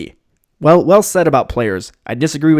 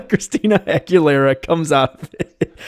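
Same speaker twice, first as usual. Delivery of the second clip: laughing; 5 semitones higher than usual. Real, not acted. Laughing at the [name] genie joke.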